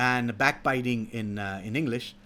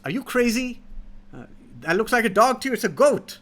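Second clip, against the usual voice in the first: higher, louder, a touch of mock outrage; indignant old farmer; 7 semitones higher, 3 dB louder than usual. Old man scoffs at the hoodlum calling his goat a dog, higher and louder. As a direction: brush him off as if he's mad.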